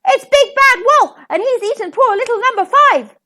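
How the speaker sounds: high, loud outraged duck; furious mother-duck squawking; enraged cry. A narrator's reading. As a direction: shriek the accusation in a rage. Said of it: "flew into a rage".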